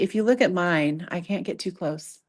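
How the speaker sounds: normal talking voice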